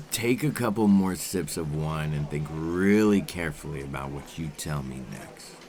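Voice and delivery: ordinary talk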